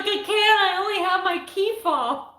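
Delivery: high-pitched mock whine; helpless, hammed-up wail into the phone; imitating a distressed caller pleading; retold tearful protest. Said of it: "I called my husband in tears".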